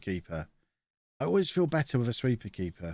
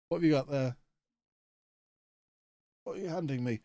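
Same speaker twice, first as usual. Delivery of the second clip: ordinary talk, nothing marked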